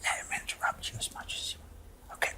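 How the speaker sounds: barely audible, voiceless muttering; very soft aside, all breath; whispered self-coaching. Real, not acted. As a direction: whisper it under your breath. Muttered self-coaching during heated interview.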